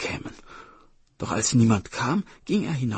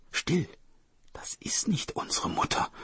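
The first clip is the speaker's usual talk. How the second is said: higher, soft near-whisper; hushed warning; 8 semitones higher, 5 dB quieter than usual. Acted. Brother warns sister about the tiger, urgent hush.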